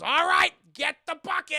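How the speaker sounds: high, loud barked command; a hammed-up shouted order for the bucket; mock bellowed order. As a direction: bark it out like a crime boss. Boss orders torture, acted.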